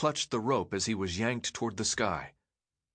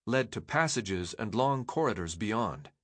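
plain reading voice

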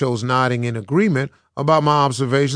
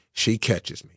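ordinary delivery, nothing marked

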